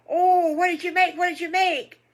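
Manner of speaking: high, loud, eager burst of questions; excited piglet. Acted. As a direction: squeal it like a thrilled little piglet. Piglet excitedly begs to know surprise.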